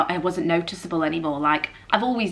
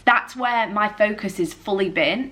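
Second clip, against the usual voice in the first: ordinary talk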